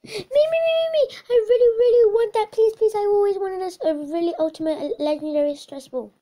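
high, loud, pleading squeals with a gasp; desperate begging. Acted. Eager kid begging the seller for the stress ball, squealing high. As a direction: beg like your life depends on it.

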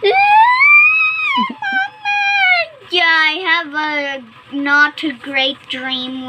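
hammed-up crying out; acted cry. A narrator's reading. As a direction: wail to mom after a bad dream. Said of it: "Ace cried".

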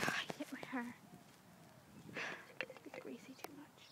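breathy whispered grumble; quiet whisper. Real, not acted. Whispering a complaint about her hair into the phone. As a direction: whisper so nobody nearby hears. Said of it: "I'm talking really, really quietly".

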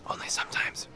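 whispered sheepish aside. Real, not acted. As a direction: whisper it like a secret.

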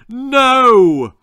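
high, loud shriek. Acted. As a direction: shriek it in fury. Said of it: "shrieked Angela's mother".

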